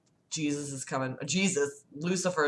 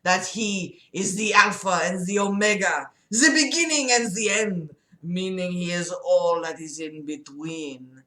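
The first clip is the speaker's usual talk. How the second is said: put-on emphatic French-accented preaching; 3 dB louder than usual. Patient arguing God allows sin, emphatic French accent. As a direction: hammer the key words in a thick French accent. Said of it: "that was totally French".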